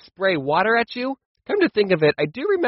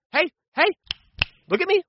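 put-on much higher, sharp "hey, hey" to call the kids to order; a mother snapping at naughty kids, pitched way up; stern mom warning; 11 semitones higher than usual. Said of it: "she would say".

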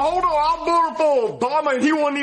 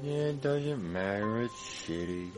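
much quieter, glum mumble; mopey sadness; 12 dB quieter, slower than usual. Acted. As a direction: sound beaten and dull, like nothing matters. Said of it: "he looks really sad".

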